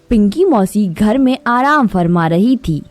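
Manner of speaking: ordinary delivery, nothing marked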